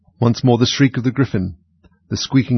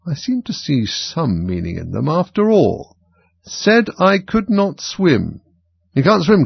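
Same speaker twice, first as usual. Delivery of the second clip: slow, rising fussy king; 8 semitones higher, slower than usual. A narrator's reading. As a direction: puzzle over the verses slowly. King reads, asks Knave.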